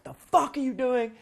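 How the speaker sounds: high, loud mock outburst; hammed-up furious yell; mock angry yell. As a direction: show the screaming he says he avoids. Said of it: "I'm not screaming at her".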